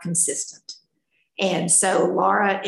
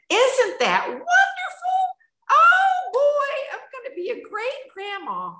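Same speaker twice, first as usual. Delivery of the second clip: thrilled delight. Retold or imitated. Grandma to pregnant granddaughter, retelling her thrilled high squeal. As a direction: gush over the news like a giddy grandma.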